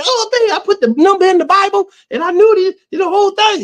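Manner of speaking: high, gushing mock parent voice; excited parent. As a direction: gush like a thrilled mother.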